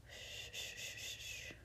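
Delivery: soft breathy shush to calm; soothing shushing. Acted. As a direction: hush the baby gently. Soothing fussy baby.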